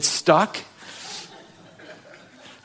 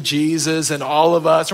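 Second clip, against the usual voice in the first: ordinary talk, nothing marked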